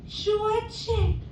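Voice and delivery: put-on high, slow singsong kid voice; sing-songy little girl. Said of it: "She's a little sing-songy".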